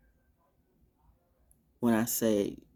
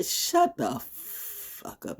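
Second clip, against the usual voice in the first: whispered aside; 5 dB quieter, much slower than usual. Real, not acted. Aside cutting off neighbors.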